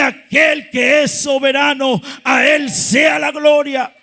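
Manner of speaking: loud, driving preacher delivery; full-force preaching. Real, not acted. Preacher telling the church it is under authority, full-force preaching. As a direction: build to a loud declaration of praise.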